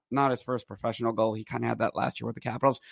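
usual speaking voice

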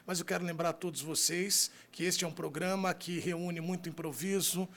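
ordinary talk, nothing marked

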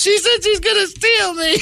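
put-on high tearful kid complaint; wailing child. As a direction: tattle like a scared little boy. Kid wails to mom in story.

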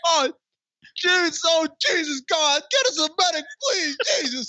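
very high frantic begging; hammed-up panicked plea to Jesus; panicked pleading. Mock panicked prayer for a medic.

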